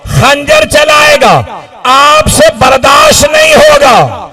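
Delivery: keening preacher's lament; lamenting sermon at full force. Acted. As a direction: cry the tragedy out to the mourners.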